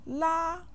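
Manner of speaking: La held high and level; first tone. Shown as a demonstration. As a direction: sustain La on one high, even pitch. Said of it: "with the first tone".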